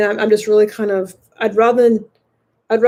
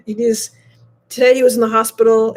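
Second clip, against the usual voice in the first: ordinary talk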